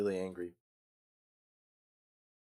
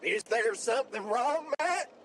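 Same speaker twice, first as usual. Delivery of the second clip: high mock voice; 9 semitones higher, 4 dB quieter than usual.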